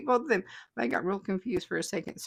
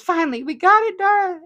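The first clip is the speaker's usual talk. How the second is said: excited; 9 semitones higher, 9 dB louder than usual. Retold or imitated. Reading a fan's joyful comment.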